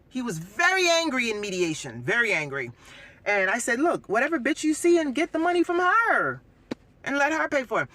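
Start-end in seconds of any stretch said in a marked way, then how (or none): 3.8-7.9 higher, pushy retelling of her retort; retold retort. Woman retelling how she told off her ex, angry. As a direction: throw it back at him with attitude.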